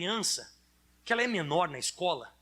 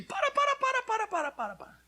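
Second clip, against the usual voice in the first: much higher, urgent cry of stop, stop, stop; shouted stop. Real, not acted. Preacher silencing complainers, high urgent shout. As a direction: cut them off with a sharp repeated stop. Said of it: "preacher cutting off the whining".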